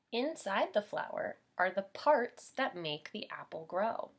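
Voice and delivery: put-on lively storybook reading for little kids; bright teacher storytime voice; reading to children. A narrator's reading. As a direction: read it like storytime for preschoolers. Teacher reads picture book to class.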